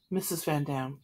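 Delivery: ordinary delivery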